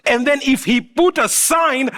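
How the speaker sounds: ordinary delivery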